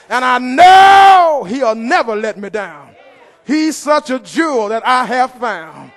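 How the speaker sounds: loud, rhythmic preacher cadence; fiery preaching. Acted. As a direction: preach it to the rafters, calling for response. Preacher at full force.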